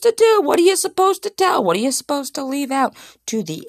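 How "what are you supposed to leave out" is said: ordinary talk, nothing marked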